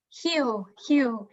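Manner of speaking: Rio said the Brazilian way, R as a breathed H; Rio de Janeiro accent. Shown as a demonstration. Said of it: "pronounced in a Rio de Janeiro accent".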